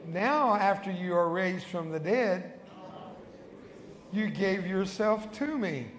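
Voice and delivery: slow, measured phrases with long pauses; solemn sinner's prayer, spoken clearly; led prayer. Real, not acted. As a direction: give each line slowly so the church can repeat it. Leads congregation in repeat-after-me prayer.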